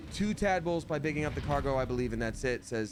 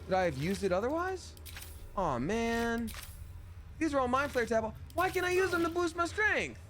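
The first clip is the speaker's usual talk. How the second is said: high, rising cries of dismay; dismayed disbelief; 10 semitones higher than usual. Real, not acted. Streamer finding dead tadpoles, high dismayed cries. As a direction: wail at the loss, pitched way up.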